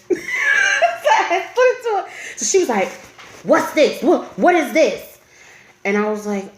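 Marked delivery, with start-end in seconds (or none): 3.4-5.2 raised-pitch, demanding mom voice; imitated maternal interrogation; stern mother. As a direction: fire questions like an alarmed strict mother. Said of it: "this stern ass voice".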